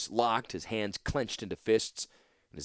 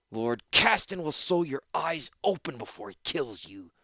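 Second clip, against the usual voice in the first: snapped threat; 5 semitones higher than usual. Acted. Torsten snapping a threat at Havero. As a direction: voice changed, biting threat. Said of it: "Torsten snapped at him".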